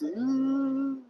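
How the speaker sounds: ghost moan. Pretend. Ghost joined the tour.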